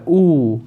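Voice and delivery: ऊ with both lips rounded and pressed; demonstrating ऊ. Shown as a demonstration. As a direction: push the lips together for ऊ. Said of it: "ऊ का जब हम उच्चारण करें तो दोनों ओठ". (होंठों से ऊ)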